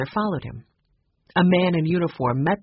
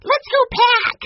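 put-on very high, loud child's cry; excited little boy; 8 dB louder than usual. A narrator's reading. Benny rushing off to pack, excited. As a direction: call it out eagerly, high and loud. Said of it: "breaking into a run".

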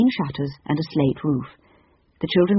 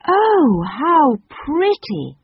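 high, loud, slow admiring cry; children marvel at the tree; delighted gasp; 10 semitones higher, 5 dB louder, much slower than usual. A narrator's reading. The children see the Christmas tree, high delighted cry. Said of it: "said everyone".

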